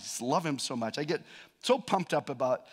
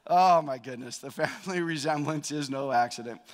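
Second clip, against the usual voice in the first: ordinary talk, nothing marked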